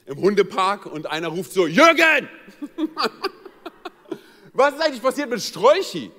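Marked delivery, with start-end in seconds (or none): 1.7-2.3 loud high call across a dog park. Retold or imitated. Man in the dog park calling his dog, loud shout. 2.3-3.9 laugh. Real, not acted. Laughing at own joke.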